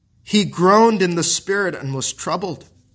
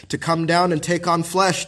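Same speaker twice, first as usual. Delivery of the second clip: ordinary talk, nothing marked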